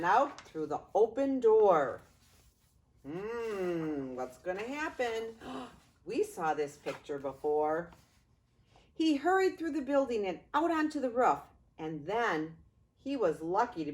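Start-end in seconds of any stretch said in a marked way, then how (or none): none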